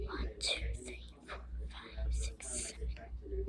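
barely audible whisper, counting to oneself. Real, not acted. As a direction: count under your breath. Recounts players under the breath.

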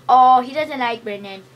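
gleeful outburst. Real, not acted. Player loudly teasing friend that the creeper dislikes him. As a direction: crow it out at Brendan.

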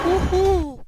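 high, loud victory cheer; excited whoop. Real, not acted. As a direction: whoop like you just won. Celebrates getting the crystal.

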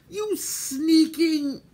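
high, slow, drawn-out angry accusation; furious cry. A narrator's reading. Terran seizing Gurgi, furious. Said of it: "Terran cried furiously".

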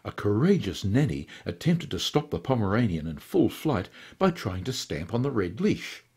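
ordinary delivery, nothing marked

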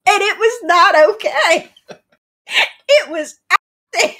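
high, loud, laughing exclamation; laughing outburst. Real, not acted. Player recalling a Brit's attempt at Texan, laughing outburst. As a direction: burst out in helpless amusement.

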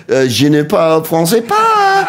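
put-on slow, halting American-accented French; clumsy American-accented French; bad American French. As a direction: stumble through schoolboy French. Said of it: "And then you go".

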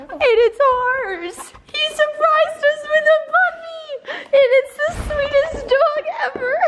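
overwhelmed by a surprise puppy; close to happy tears. Real, not acted. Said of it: "I'm, like, about to cry".

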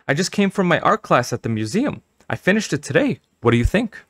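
proud mother. Acted. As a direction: tell her proudly, pitched high.

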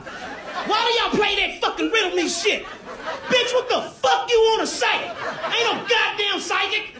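high, exasperated ranting at the riddles. Acted. Fed-up boyfriend to his girlfriend, high exasperated rant. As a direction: vent like a fed-up boyfriend who is done guessing.

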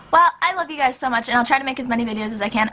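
rapid, hyper rush of words; talking fast. Real, not acted. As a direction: rattle it off quickly. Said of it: "I'm really talking fast today".

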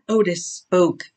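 plain reading voice

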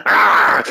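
loud, high mock-savage yell; short savage dog-fight yell; ravenous dog snarl. Player egging friends on to fight, mock dog yell. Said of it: "fight like ravenous dogs".

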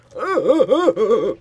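high, loud strangled yelp; death cry. Acted. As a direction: let out a dying squeal. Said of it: "That's my death noise".

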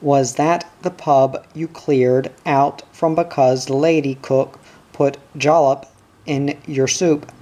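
plain reading voice

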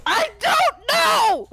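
high, loud, drawn-out yell; real high-pitched outburst; exasperated shout. Real, not acted. Exasperated reply to "good for you.

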